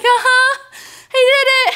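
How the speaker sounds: high, loud squeak of relief; shaken relief. Retold or imitated. Rider just off the coaster answering a friend, shaken squeak. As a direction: act out rattled triumph.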